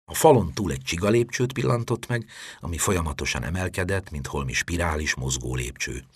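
ordinary delivery, nothing marked

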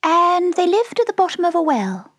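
very high, small creature voice; Dormouse storyteller. A narrator's reading. The Dormouse telling its tale, high and sleepy. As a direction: squeak the story along.

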